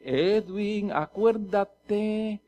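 soft, very drawn-out call; slow, hushed divine reminder; Holy Spirit's prompting. Acted. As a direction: gently stretch out each word like an inner voice. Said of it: "el Espíritu Santo me empieza a decir".

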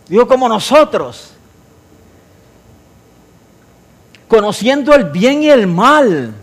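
full-force sermon delivery; preaching. Acted. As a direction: proclaim the verse to the congregation. Preaching the Genesis passage.

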